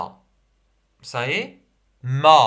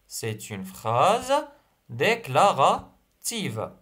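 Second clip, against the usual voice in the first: C'est une phrase déclarative, slowly, for writing down; slow dictation voice; slower than usual. Shown as a demonstration. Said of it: "Alors, c'est une phrase déclarative".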